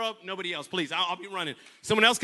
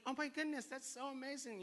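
hinting gush; 5 semitones higher, 11 dB quieter than usual. Retold or imitated. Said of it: "sends subtle hints".

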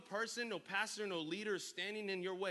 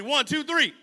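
rally the crowd with a shouted count; shouted count to cue the crowd's response; 10 semitones higher, 17 dB louder than usual. Real, not acted. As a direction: count it in loud and high. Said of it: "say it like you mean it".

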